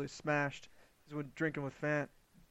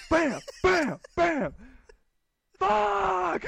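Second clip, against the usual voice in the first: high mock yells, bam bam bam then a cry of fuck; pitched-up retold racket and yelp; imitating drunk brother's banging; 7 dB louder, much slower than usual. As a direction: act out a drunk kid pounding the bed and shouting. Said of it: "It's like".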